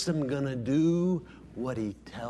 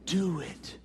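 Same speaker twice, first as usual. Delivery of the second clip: ordinary talk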